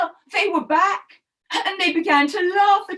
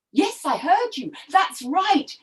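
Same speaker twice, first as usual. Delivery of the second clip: usual speaking voice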